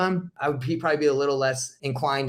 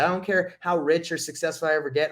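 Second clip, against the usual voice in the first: ordinary talk, nothing marked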